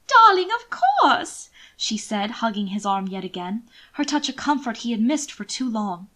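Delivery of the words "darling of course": higher, louder, drawn out gushing; lady's slow, loud effusive reply; effusive darling. Acted. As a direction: gush it slowly, delighted. Mina eagerly agrees.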